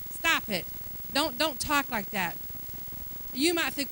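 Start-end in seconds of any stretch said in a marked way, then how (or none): none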